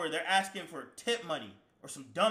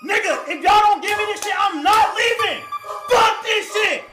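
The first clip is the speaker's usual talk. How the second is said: screaming, much higher and louder; furious yell; 13 semitones higher, 12 dB louder than usual. Retold or imitated. Demanding his stolen money from the crew, screaming. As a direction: scream it at everyone in the house. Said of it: "Chat, and I'm this loud".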